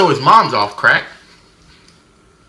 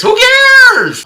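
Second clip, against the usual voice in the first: high, loud "who cares" shout; exasperated yell; 9 dB louder than usual. Acted. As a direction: scream it off dismissively. Running dismissive gag about the movie.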